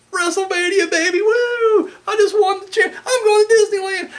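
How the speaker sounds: imitating a sobbing wrestler, high-pitched and wailing; hammed-up tearful cheer, stretched out on "baby, woo"; mock crying winner. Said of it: "he's, like, crying".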